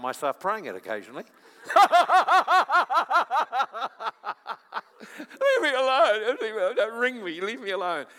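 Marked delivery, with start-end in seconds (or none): 1.7-4.8 long, high, loud staccato laugh; loud cackling laugh. Acted. Preacher breaks up the congregation, long loud cackling laugh. 5.2-8.1 mock demon's plea. Preacher jokes he prays the demon's prayer, mock wailing plea.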